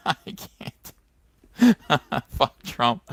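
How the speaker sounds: real laughter breaking into the words; too amused to get it out; high, helpless laughing. Real, not acted. Laughing at what he just read.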